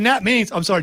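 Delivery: usual speaking voice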